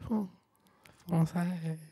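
put-on whispered French accent; secretive fake French accent; whispered mock French. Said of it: "I'm from Paris".

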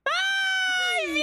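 very high, excited shriek; screaming cheer. Real, not acted. Celebrating her friend's jump, shrieking cheer.

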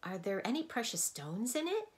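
excited. A narrator's reading. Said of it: "asked Milo excitedly".

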